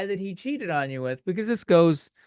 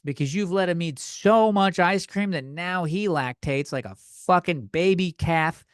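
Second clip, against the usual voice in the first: normal talking voice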